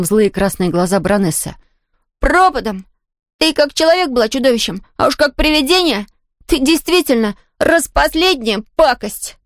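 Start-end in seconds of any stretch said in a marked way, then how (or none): 2.2-9.4 high-pitched defiant outburst; trembling cry. A narrator's reading. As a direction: keep the voice high, scared but defiant. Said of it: "крикнул дрожащим голосом".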